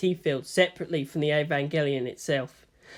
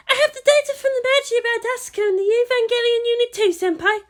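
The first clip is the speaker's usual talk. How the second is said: put-on light, much higher girlish voice for Maya; eager assistant to her senior, higher voice; young Maya voice; 5 dB louder than usual. A narrator's reading. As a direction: play the eager junior assistant, high and bright.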